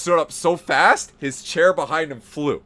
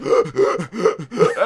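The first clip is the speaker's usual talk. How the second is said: mock panting gasp; 5 semitones higher, 6 dB louder than usual. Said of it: "And Jared's like".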